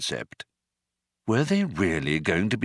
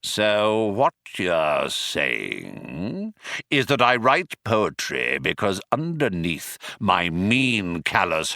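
very slow, rasping Vogon; cat snagging brushed nylon; slower than usual. Acted. The Vogon menacingly questions the captives, slow and grating. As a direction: scrape it out slowly. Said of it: "quality of a cat snagging brushed nylon".

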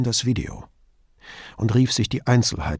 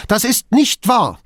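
high, loud, heated denial; agitated protest at a high pitch; angry or panicked; 11 semitones higher, 7 dB louder than usual. A narrator's reading. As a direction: deny it with voice raised in alarm. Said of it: "lief rot an vor Zorn oder Panik".

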